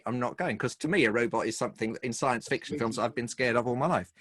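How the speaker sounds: ordinary delivery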